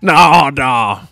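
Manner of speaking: anguished high shout of a friend's name; Marcus Phoenix voice. Pretend. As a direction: yell it out like watching a comrade die. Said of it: "It's my Marcus Phoenix voice".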